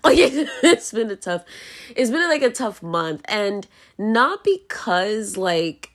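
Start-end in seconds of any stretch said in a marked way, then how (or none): 0.0-1.4 slower, higher, laughing through it. Real, not acted. Telling viewers about a rough broke month, laughing. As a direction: laugh it off while admitting it. Laughing at own money troubles.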